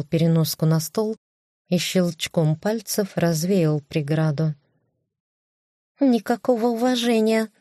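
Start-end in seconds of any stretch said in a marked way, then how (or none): 6.0-7.5 high haughty huff of a cat; snooty bored cat. Acted. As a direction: sniff it out like an offended cat. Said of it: "надменно фыркнула кошка". (надменный кошачий фырк)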